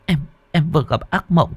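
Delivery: high, halting, softened voice; frightened. Acted. Wife telling husband of her nightmare, frightened and faltering. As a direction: stammer it out, still shaken by a nightmare.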